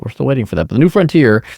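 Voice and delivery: ordinary talk, nothing marked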